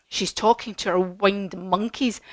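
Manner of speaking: ordinary talk, nothing marked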